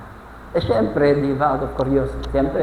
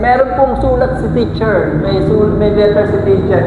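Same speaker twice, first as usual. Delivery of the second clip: acted child calling out news, raised and high; pasigaw na balita; 4 semitones higher, 5 dB louder than usual. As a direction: announce it loudly to mom. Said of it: "sigaw siya". (pasigaw na pagbabalita ng bata)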